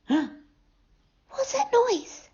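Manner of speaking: put-on high, soft startled girl voice; a frightened little girl asking; Goldilocks voice. A narrator's reading.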